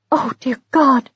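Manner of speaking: a touch of horror; shocked gasp. Acted. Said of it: "said Missus Polifax".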